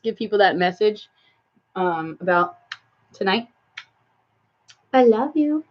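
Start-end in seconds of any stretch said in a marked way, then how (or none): 4.9-5.6 high cooing endearment; affectionate pet talk. Real, not acted. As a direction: coo it up high to your pet.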